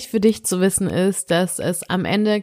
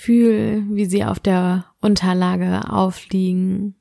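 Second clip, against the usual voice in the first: sleep meditation; slower than usual. Acted. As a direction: guide the listener gently into sleep.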